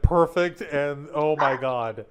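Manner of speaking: loud, overwhelmed exclamation; losing composure. Real, not acted. Listener lets the horrible, perfect zinger sink in, losing composure. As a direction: exclaim loudly, struggling to compose yourself. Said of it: "actually trying to compose myself".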